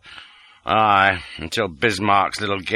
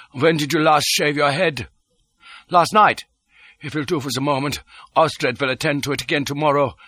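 higher, crisp lordly voice; cool aristocrat; 6 semitones higher than usual. A narrator's reading. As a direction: give orders with ease.

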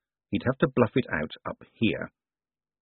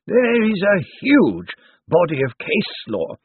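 higher, slow, dusty legal voice; dry old zombie lawyer; 8 semitones higher, 4 dB louder, slower than usual. Acted. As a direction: ancient lawyer weighing every word. Slant cites case law.

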